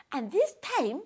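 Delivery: usual speaking voice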